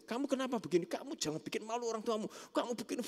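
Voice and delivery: high-pitched mock scolding; judging parent. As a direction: tell a child off straight away, pitched high. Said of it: "Kalau judging itu langsung diajar".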